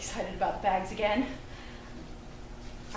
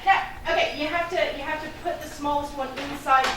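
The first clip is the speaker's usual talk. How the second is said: normal talking voice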